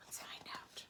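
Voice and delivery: soft whispered invitation. Real, not acted. Teacher whispering to children. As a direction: whisper the tease.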